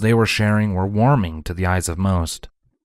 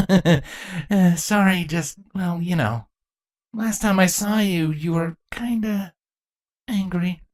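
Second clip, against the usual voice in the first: Thorax sheepish; 11 semitones higher, slower than usual. A narrator's reading. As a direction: laugh it off nervously, trailing away. Thorax voiced as figure.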